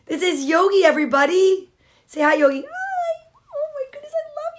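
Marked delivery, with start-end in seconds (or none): 2.6-4.6 tiny, very high, soft squeak; puppy voice. Pretend. Reader voicing the puppy saying hi, high squeaky voice. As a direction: squeak adoringly like a little pup.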